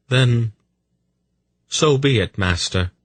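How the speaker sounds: sighed acceptance. A narrator's reading. Polgara yields to her master, with resignation. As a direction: accept it reluctantly, pausing after the first word. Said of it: "Polgara sighed".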